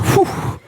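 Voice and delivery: a quick whispered sigh of relief, barely voiced; relieved whispered whew. Relief after dodging the question.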